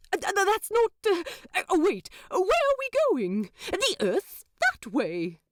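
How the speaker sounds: high, stammering angel voice; sputter, then ask in alarm; flustered angel. A narrator's reading. Aziraphale chasing the demon, high and stammering.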